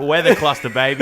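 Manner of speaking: cocky loud boast; loud party boast. Pretend. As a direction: gloat it to the crowd.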